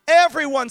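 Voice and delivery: preacher leaning on "everyone" for the crowd; stress everyone. Shown as a demonstration. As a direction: hit "everyone" alone, firm and falling. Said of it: "somebody say everyone".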